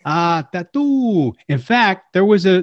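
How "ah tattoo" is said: Mr. Roarke impression. Answering as Mr. Roarke.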